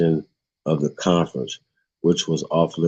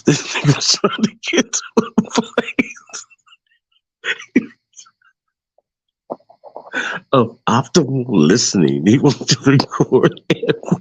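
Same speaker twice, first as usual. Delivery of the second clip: laughing; 8 semitones higher than usual. Real, not acted. Trying to keep reading, still laughing high. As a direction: fight to talk through laughter.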